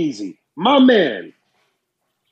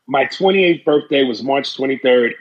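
ordinary talk, nothing marked

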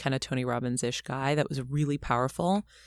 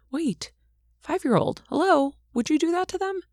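sudden self-reminder; 13 semitones higher than usual. Retold or imitated. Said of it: "I was like".

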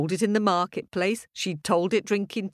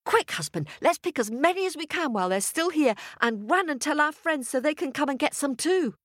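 high, quick farmer's wife; eager, high-pitched rush; excited urging; 9 semitones higher, faster than usual. Acted. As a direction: hurry him along eagerly, pitched high. Wife urges husband to hurry.

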